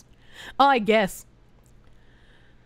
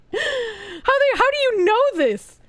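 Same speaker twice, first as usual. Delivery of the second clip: gasping disbelief; 10 semitones higher, slower than usual. Real, not acted. Shocked at chat knowing names.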